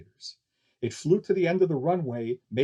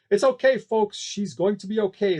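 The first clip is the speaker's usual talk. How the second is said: higher, louder calming call; loud reassurance; 6 semitones higher, 5 dB louder than usual. Acted. Barker calming the scared crowd, loud reassurance. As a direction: soothe the crowd at full volume. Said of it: "the barker announced".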